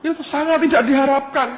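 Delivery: very high, loud cry of emphasis; loud emphatic exclamation. Real, not acted. Preacher telling congregation how unwelcome he was, loud exclamation. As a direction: hammer it home at the top of the range.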